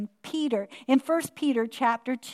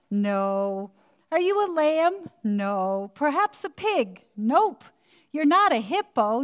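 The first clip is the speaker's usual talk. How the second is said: put-on children's story reading; 3 dB louder than usual. Grandmother reading a favorite picture book to the congregation, playful storytelling.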